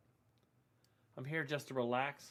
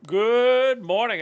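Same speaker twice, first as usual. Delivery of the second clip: slow, loud, high greeting; drawn-out shout; 8 semitones higher, 15 dB louder, much slower than usual. Acted. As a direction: stretch the greeting out big.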